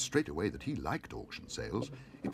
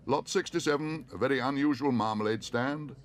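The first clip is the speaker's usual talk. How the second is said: higher, louder auctioneer's announcement; auctioneer announcing; 6 semitones higher, 5 dB louder than usual. A narrator's reading. Auctioneer announces the marmalade stand. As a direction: present the lot.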